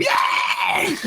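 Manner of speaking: gleeful cartoon kid squeal; squeaky kid cheer. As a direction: cheer like a tiny overjoyed gremlin. Happy little gremlin kid cheering.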